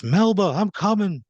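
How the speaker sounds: high, loud desperate yell; screaming for Melba. Acted. Marshall screams to Melba as he runs. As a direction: scream her name as you run to her. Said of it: "He screams".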